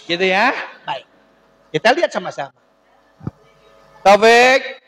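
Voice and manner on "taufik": calling out. Acted. Boss calls his servant.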